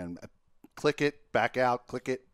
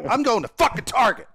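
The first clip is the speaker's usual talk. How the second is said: fed-up outburst; 10 semitones higher, 6 dB louder than usual. Retold or imitated. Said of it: "I'm like".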